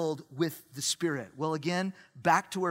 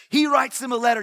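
loud, raised declaration; emphatic; 3 semitones higher, 7 dB louder, faster than usual. Real, not acted. Preacher drives home Paul's response, loud and emphatic. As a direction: ring it out to the back row. Emphatic repeat of previous line.

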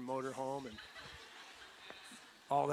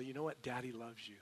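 tender dad; 2 semitones lower, 9 dB quieter than usual. Retold or imitated. Father tenderly telling daughter.

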